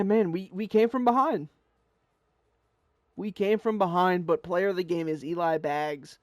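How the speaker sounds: usual speaking voice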